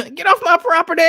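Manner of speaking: loud, high cartoon landowner yell; Fuzzy Lumpkins. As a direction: holler like an angry cartoon character chasing off trespassers. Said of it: "That remind me of, uh, y'all remember, uh, Fuzzy Lumpkins".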